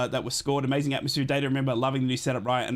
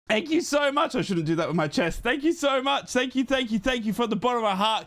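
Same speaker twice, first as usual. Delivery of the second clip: excited thanks; 7 semitones higher than usual. Real, not acted. Thanking the donor after shouting.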